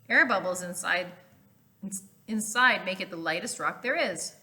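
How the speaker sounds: usual speaking voice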